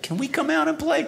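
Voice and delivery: a hidden toy begging to play; tiny toy voice. Retold or imitated. Beanie Babies in the bag plead, high squeaky voice. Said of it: "there's this little voice is like".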